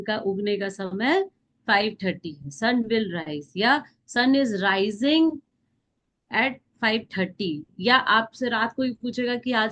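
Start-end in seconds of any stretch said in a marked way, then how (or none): 2.5-7.5 slow dictation. Shown as a demonstration. Teacher dictates example sentences.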